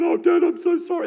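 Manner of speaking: high, loud mock whine; whining kid. Mimicking kids who come in moping, high whining. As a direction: whine like a sorry child.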